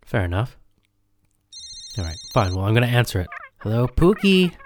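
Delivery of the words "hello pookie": high sugary phone greeting; sweet talk. Acted. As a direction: coo it like a pet name.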